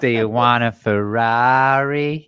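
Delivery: slow, drawn-out sultry ad voice; Anna Nicole Smith. Retold or imitated. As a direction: purr it out slowly like an old diet-pill ad. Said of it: "that's Anna Nicole".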